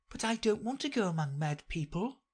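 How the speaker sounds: put-on high storybook girl voice; protesting Alice. A narrator's reading. Alice tells the cat she avoids mad people, protesting. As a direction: object in a small high voice.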